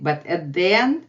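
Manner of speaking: usual speaking voice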